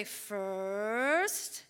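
put-on slow, sing-song child reciting each step; kids reciting routine. Mom imitating her kids chanting their schedule, sing-song and slow. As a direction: spell out the bedtime routine like a small child. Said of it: "they would say".

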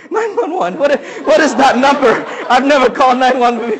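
high, loud, laughing panic; flustered bystander laughing, unsure how to call for help; nervous laughing. Retold or imitated. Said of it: "I was so nervous".

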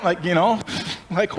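ordinary talk, nothing marked

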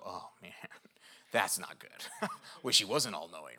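half-laughing, mildly embarrassed; sheepish laugh. Retold or imitated. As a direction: chuckle as if caught out. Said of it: "at first you go".

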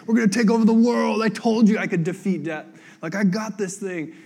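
loud, high, hammed-up conquering boast; mock crazy victory shout. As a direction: play a risen hero gone wild, shouting. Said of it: "like, crazy".

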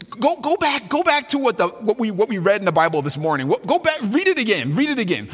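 thrilled discovery, voice leaping up; excited. Retold or imitated. Betsy tells her sister God answered, excitedly. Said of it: "she says".

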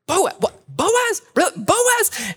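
very high, incredulous repeats of Boaz; a stunned mother-in-law hearing big news; astonished Naomi. Retold or imitated. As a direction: squeak the name over and over in disbelief. Naomi voiced, repeated astonished name.

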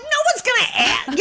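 very high-pitched incredulous protest; exasperated retort. Retold or imitated. Exasperated retold reply to mother.

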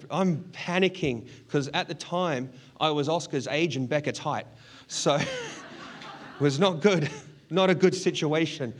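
ordinary delivery

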